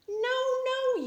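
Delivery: storybook voice, high and teasing; mock correction. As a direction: squeak it like a shocked child. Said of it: "you say".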